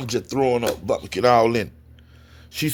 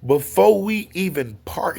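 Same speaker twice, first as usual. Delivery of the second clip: ordinary talk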